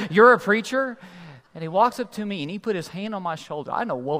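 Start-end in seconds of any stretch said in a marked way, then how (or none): 0.1-1.0 loud, high incredulous question; shocked disbelief. Shown as a demonstration. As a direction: exaggerate the shock for laughs. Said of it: "like they're shocked".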